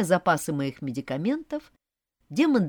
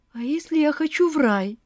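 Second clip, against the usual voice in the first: whimpering; 10 semitones higher, 5 dB louder than usual. A narrator's reading. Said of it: "всхлипнула я".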